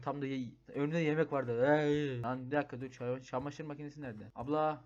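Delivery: ordinary talk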